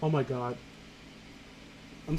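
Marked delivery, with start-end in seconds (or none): none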